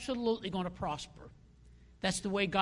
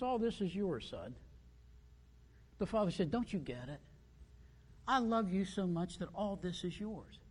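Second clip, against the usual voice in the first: much softer, gentle fatherly reassurance; father comforts his sulking son, soft and tender; 9 dB quieter than usual. Retold or imitated. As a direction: hush it like a loving dad. Father gently answers resentful son.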